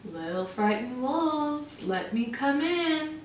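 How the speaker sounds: slow, soft, sly coaxing; sly pig voice. Acted. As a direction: wheedle them softly, slowly. Pig rang the bell.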